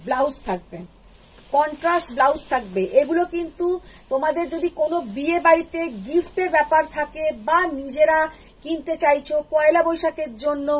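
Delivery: normal talking voice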